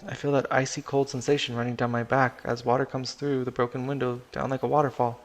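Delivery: ordinary delivery, nothing marked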